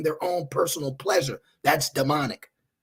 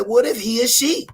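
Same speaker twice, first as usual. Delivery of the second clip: mock quote; 7 semitones higher, 4 dB louder than usual. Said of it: "She said".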